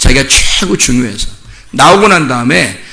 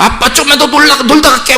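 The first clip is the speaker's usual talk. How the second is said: put-on high-pitched teen begging for more playtime; pleading child; 10 semitones higher than usual. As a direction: imitate a kid wheedling a parent. Acting teen begging to stay out. (더 놀겠다고 조르는 아이 흉내)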